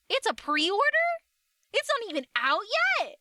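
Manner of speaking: high, loud, disbelieving; shocked disbelief, voice shooting up. Real, not acted. Shocked by figurine still selling.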